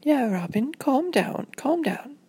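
lightly voiced parent saying settle down; gentle mock-parent reassurance; soothing parent. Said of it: "Robin's parents were like".

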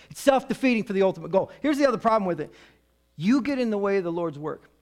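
normal talking voice

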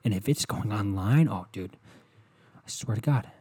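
quiet, menacing mock threat; a hint of a seething partner. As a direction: threaten under your breath. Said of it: "you go".

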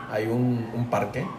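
ordinary talk, nothing marked

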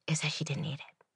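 hushed secret telling; conspiratorial whisper. Acted. To viewers about the coleslaw lady, whispered. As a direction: whisper the punchline.

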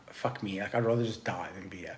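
low, soft defeated aside; resigned, under the breath; retold despair. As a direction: say it quietly, as if giving up. Retold despair while bonking.

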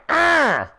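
high-pitched growling roar of anger; rage roar. Retold or imitated. Retelling his fury at the scorpion that stung him, rage roar. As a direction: roar it out like venting on an enemy. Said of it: "I fucking raged on him".

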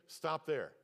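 ordinary delivery

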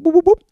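high, loud mock electronic whoop; Zoom connect sound. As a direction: chirp it like a video call connecting.

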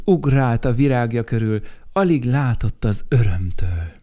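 usual speaking voice

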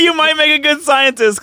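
high laughing mockery of the line. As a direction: giggle it out in a squeaky put-on voice. Mocking Hojo's line, laughing.

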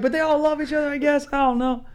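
high, unsure whining; whiny shrug voice. Retold or imitated. Voicing buddies' shrug.

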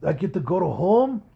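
put-on Japanese accent, hopeful question. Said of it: "stereotypical Japanese accent".

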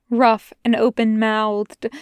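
ordinary delivery, nothing marked

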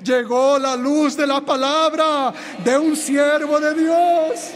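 slow, loud, high shouted proclamation; preaching at full force. Acted. Preacher to congregation, slow shouting climax. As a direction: thunder it to the church.

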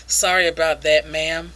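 low voice after a chuckle; imitating a sheepish, low-pitched "oops" apology; sheepish mock apology. Sheepish apology after being scolded.

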